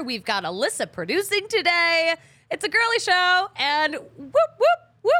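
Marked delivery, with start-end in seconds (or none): 4.6-5.1 high, loud whooping air horn noise; mock air horn. Host imitating an air horn to open the girly show, loud high whoops. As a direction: blast out a pretend air horn, much higher and louder. Are air horns too masculine.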